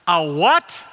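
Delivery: a loud, high, startled "A what?"; mock loud outburst of disbelief; retold shout. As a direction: imitate her yelling across the airport. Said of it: "At the top of her voice".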